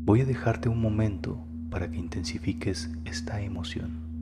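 guided meditation. Acted. Guide leading listeners through sacral chakra breathing, soft guided meditation. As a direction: guide the listener inward, softly and unhurriedly.

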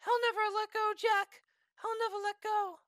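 high melodramatic parody plea; mock Titanic Rose. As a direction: ham up the tearful film line.

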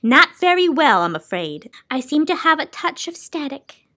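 high, plaintive lament; a touch of self-pity in a high lady's voice; complaining Soundkeeper. Acted. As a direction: moan a little about being unwell. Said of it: "she complained".

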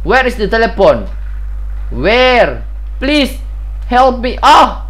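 hammed-up frightened cry; mock panic. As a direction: plead for help like a scared victim. Mock panic looking for phone.

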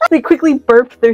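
high voice collapsing into real laughs; reading through laughter. Real, not acted. Reader stuck on burped cigarettes, helpless laughter. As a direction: struggle to get the sentence out, cracking up.

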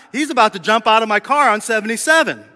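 ordinary talk

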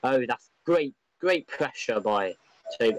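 ordinary talk

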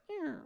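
high, soft creaking noise; squeaky door sound; imitated creak.

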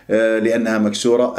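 ordinary talk

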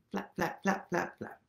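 normal talking voice

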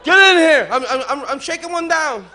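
loud, high shouting father; hammed-up shout from the yard; father yelling. Father shouts orders at his son, loud and high.

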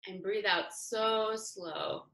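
drawn-out, falling breathing cue; slow breathing guidance. Real, not acted. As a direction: draw the words out with the exhale.

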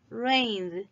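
"rained" as one syllable, "raynd"; voiced final D. Shown as a demonstration. Teacher says repeat after me, voiced final D. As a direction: end "rained" on a vibrating d, no e. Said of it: "es con vibración".

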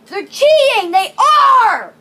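loud, high-pitched gamer rage; outraged yelling. Real, not acted. Player accusing the game of cheating, angry yelling. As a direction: yell in real outrage at being cheated.

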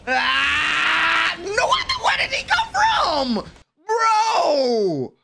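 high cracking shout of disbelief; shocked cry. Real, not acted. Fan sees a defender appear from nowhere, high shocked cry. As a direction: yelp high, caught totally off guard.

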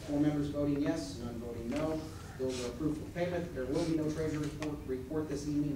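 ordinary delivery

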